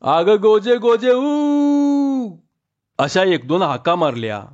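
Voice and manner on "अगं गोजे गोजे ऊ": long drawn-out call across the fields, much higher. A narrator's reading. Farmer calls his daughter from the field, long high holler. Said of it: "आणि हाक मारली".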